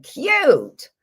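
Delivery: high, cute little voice reading the comment; cutesy reading. Retold or imitated. Reading a viewer comment aloud in a high cutesy voice. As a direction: squeak out the word like a cutesy fan. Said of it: "She said".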